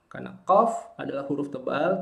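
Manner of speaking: ordinary talk, nothing marked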